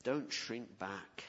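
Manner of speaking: slow, low plea; slow pastoral plea; slow earnest urging. Acted. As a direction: lean in and say it slowly.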